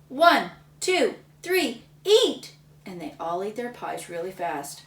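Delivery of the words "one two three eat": louder, higher countdown; judge yelling. Acted. Judge starting the pie contest, loud countdown. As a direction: call the start like a referee. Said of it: "He yelled".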